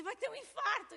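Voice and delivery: imitating a frightened crowd; alarmed cry. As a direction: blurt it out in alarm. Crowd panics over sick man.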